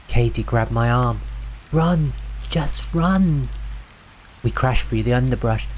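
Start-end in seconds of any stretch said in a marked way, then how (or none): 1.7-3.5 high, urgent cry; urgent warning. A narrator's reading. Navigator to friends fleeing the voice, urgent cry. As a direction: urge them to flee, pitched up. Katie urges friends to flee.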